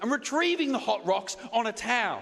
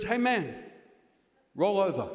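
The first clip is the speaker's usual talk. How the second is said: low, casual command; masseuse's order; 6 semitones lower than usual. Retold or imitated. Comic imitating the masseuse telling him to turn, low and casual. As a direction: drop into the curt masseuse. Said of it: "She goes".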